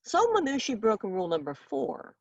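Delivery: plain reading voice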